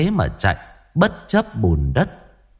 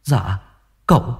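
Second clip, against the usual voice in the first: put-on higher, stammering frightened boy; trembling servant; 11 semitones higher than usual. A narrator's reading. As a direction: force out the terrible news in pieces. Servant stammers son fell in pond.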